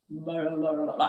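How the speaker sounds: low, soft nonsense mumble; flustered babble. Retold or imitated. Retelling own starstruck reply to favourite artist, flustered mumble. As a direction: babble under your breath, low and quiet. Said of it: "I don't even know what I said".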